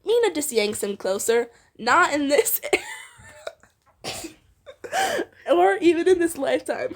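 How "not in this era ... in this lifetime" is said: laughing. Real, not acted. Laughing while reading dialogue.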